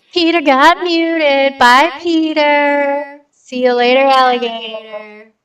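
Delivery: slow, drawn-out mock goodbye; sing-song farewell. Streamer gloating over a muted viewer, slow sing-song goodbye.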